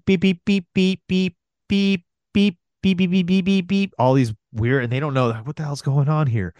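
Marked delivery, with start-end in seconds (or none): 0.1-3.9 high mimicked electronic beeps; chirpy machine beeps; imitated beeping. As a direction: beep like a machine gun alarm. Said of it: "starts beeping".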